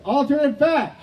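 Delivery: high shouted call to the crowd; calling for votes. Real, not acted. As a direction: shout out the second choice. Host calls for audience vote.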